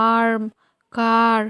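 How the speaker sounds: "আর্ম", "কার" said singly with an open "aa" vowel; "আর্ম, কার" as separate teaching words with long "aa". Shown as a demonstration. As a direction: model the "aa" sound in "আর্ম" and "কার". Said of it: "আয়ের উচ্চারণ কিন্তু".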